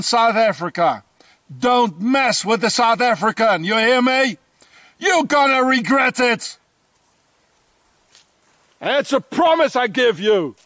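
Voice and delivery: real fury aimed at the Swiss; angry rant. Real, not acted. Ranting at the Swiss authorities.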